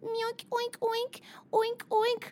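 high squeaky pig oinks; piggy oinks. Acted. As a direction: oink like a little pig. Pig noises in foot bit.

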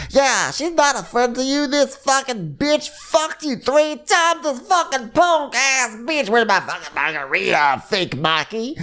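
high, slow, screechy imitation of a rant; hammed-up drunken-style outrage, words stretched; mock tirade. Ranting about a false friend, mock screeching tirade.

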